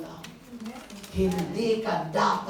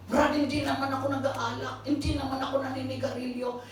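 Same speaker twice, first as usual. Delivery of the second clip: higher, mock defensive excuse; defensive buddy; 6 semitones higher than usual. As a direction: protest innocence like a buddy. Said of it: "defensive imagined listener".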